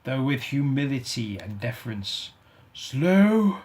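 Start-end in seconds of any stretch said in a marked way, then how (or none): 2.7-3.6 loud, high outraged echo; indignant ghost. Acted. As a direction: repeat his word in disbelief. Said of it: "the ghost repeated".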